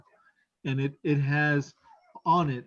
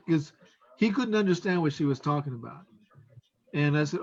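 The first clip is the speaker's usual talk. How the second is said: normal talking voice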